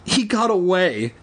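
out of breath, stammering reply; panting. A narrator's reading. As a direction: answer while out of breath.

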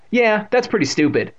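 ordinary delivery

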